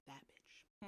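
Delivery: very soft, unvoiced aside; muttered insult. Real, not acted. Recapper on the traitor Ianthe, muttered. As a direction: whisper it under your breath.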